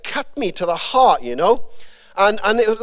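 usual speaking voice

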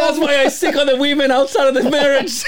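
squeaky laughing punchline. Real, not acted. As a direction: push the line out through laughter.

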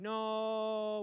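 one high, held "No"; long drawn-out no. Retold or imitated. Retelling his reply to her message, long drawn-out no. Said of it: "with, like, ten O's after the end".

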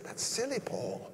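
high, soft mock scolding; mock dismissive. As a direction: imitate someone pooh-poohing it in a high voice. Mimics objectors scoffing at Paul.

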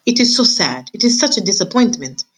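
put-on lightly French-accented sigh of disappointment; French lament. French mother mouse complains to her husband, mild French-accented lament. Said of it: "She was a French mouse".

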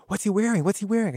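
excited photographer asking twice; eager question. Retold or imitated. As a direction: press for the scoop.

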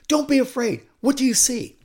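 loud, high, urgent questioning; urgent king. Acted. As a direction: play a king pressing a frightened medium. King urging the frightened woman.

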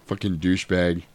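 ordinary talk, nothing marked

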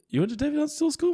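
high, fast astonished question; mock surprise. Imitating comedian Fortune's shocked reaction. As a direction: squeal it like you can't believe it. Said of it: "She's like".

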